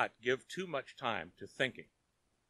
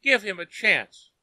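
higher, louder coaxing plea; pleading; 8 semitones higher, 9 dB louder than usual. A narrator's reading. Daughter pleading with father for Tom, pleadingly. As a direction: daughter begging father to relent. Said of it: "she pleaded".

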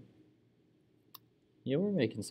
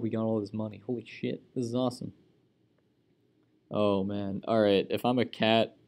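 ordinary talk

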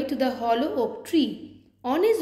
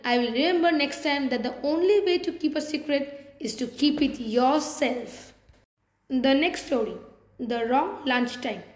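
ordinary delivery

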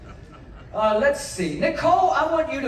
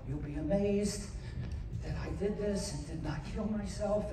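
usual speaking voice

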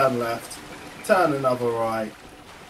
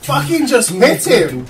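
frustrated shout; 9 semitones higher, 10 dB louder, slower than usual. Real, not acted. Frustrated watching the fight.

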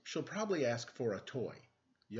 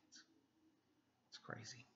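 whisper; 20 dB quieter than usual. Real, not acted. Whispered aside about fuzzy look.

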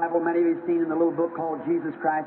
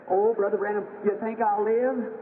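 put-on frightened sick girl; 5 semitones higher than usual. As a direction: ask it like a scared child begging for hope. Dying girl asks preacher.

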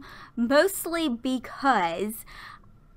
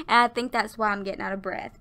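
usual speaking voice

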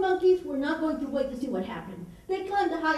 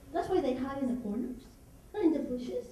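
plain reading voice

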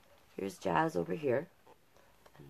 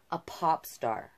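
usual speaking voice

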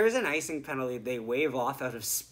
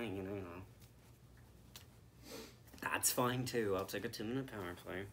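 normal talking voice

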